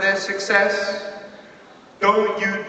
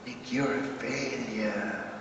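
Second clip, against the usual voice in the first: much softer, slow, defeated; depressed self-talk; 8 semitones lower, 11 dB quieter, slower than usual. Retold or imitated. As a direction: tell yourself you failed, crushed. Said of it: "I was depressed".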